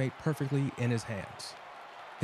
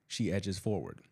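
ordinary delivery

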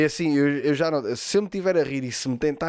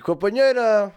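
high, drawn-out playful call; 6 semitones higher, 4 dB louder than usual. Pretend. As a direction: call to a partner in the next room. Jokingly calling out to partner. (chamar em voz alta, a brincar)

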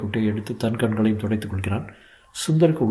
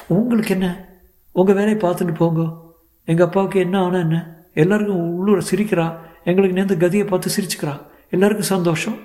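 bitter outburst; 9 semitones higher than usual. Acted. Daughter lashing out at neighbour.

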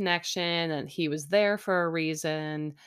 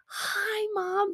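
high, squealed mock greeting; excited greeting. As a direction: imitate a thrilled daughter at the door, very high. Imagined usual excited door greeting.

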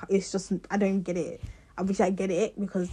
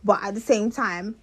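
ordinary talk

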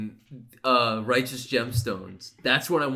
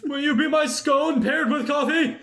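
high, hammed-up proposal voice; mock proposal; 5 dB louder than usual. Pretending to propose with menu items, high hammed-up voice. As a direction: propose to a scone, overdone.

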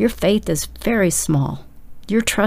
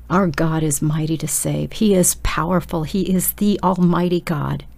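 ordinary delivery

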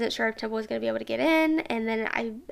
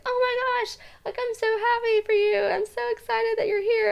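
much higher, tearful happy squeal; overjoyed reunion; 11 semitones higher than usual. Retold or imitated. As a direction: greet her like a lost child found again, near tears. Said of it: "I was like".

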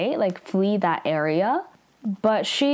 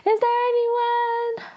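high, tearful cries for help; crying for help. Retold or imitated. Aro alone in the forest, crying out for help. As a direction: mimic a sobbing girl lost and calling out. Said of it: "she's, like, crying".

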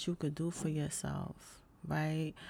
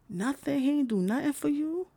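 indignant; 10 semitones higher, 4 dB louder than usual. Real, not acted. Outrage at the boyfriend's empty Valentine's.

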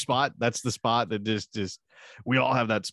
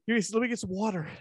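high, mock-winded plea; out of breath; 11 semitones higher, much faster than usual. Winded man stalling his caller, mock breathless. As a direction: act puffed out and stalling. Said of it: "Why are you so out of breath?".

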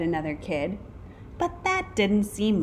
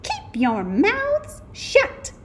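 slow, high-pitched strict order; mean teacher; 14 semitones higher, 4 dB louder, slower than usual. A narrator's reading. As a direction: scold the class, drawing out each word. Mean substitute orders the class.

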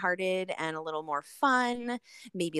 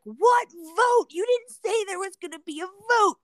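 indignant protest; 11 semitones higher, 7 dB louder than usual. Retold or imitated. Retelling complaint about the vote.

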